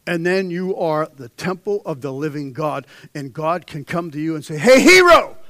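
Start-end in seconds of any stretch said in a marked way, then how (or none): 4.6-5.4 loud, high summons; calling out. Pretend. God calling a believer to a job, loud call. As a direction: hail him from across the room.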